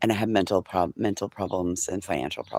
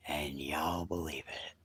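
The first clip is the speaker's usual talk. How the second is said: slow, soft whisper to the viewers; whispered aside; 5 semitones lower, 14 dB quieter, slower than usual. Real, not acted. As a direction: let them in on a secret, barely voiced.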